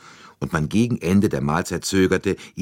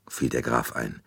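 ordinary delivery, nothing marked

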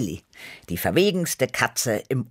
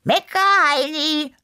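high, loud yell back; stretched-out taunting shout; shouted retort; 5 dB louder than usual. A narrator's reading. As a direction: holler an insult across the yard. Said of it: "kam es zurück".

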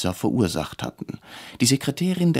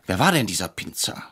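ordinary delivery

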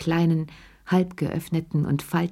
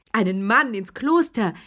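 neighing laugh; 5 semitones higher than usual. A narrator's reading. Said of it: "wieherte Madame de la Berge mit ihrem tiefen Bass".

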